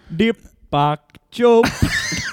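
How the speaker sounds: very slow, drawn-out mock Indian accent. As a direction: draw the name out in a put-on Indian accent. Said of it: "God forbid you do the accent".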